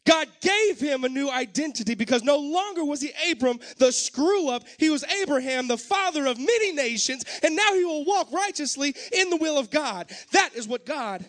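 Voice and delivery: high, loud sermon climax; impassioned pulpit shouting; full-force preaching. Real, not acted. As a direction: preach it at the top of your range, driving each key word home.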